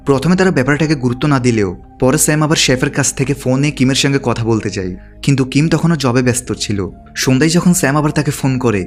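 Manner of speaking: usual speaking voice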